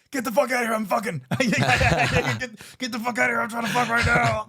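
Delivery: high, loud mock yell at the roommate; retold yell. Yelling at the roommate who walked in, high and loud. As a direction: bark it out like you were caught mid-act. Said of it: "and I go".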